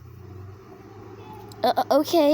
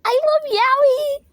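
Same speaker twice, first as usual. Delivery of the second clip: much higher, louder, slow squealing; fangirl squeal; 6 dB louder, slower than usual. Acted.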